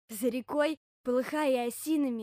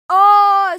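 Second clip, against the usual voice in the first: long, loud, high yell; loud drawn-out story-time scream; bear cub's yell; 9 semitones higher, 23 dB louder than usual. Acted. Bear cub shouting to show up the silence, long high yell. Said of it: "закричал медвежонок".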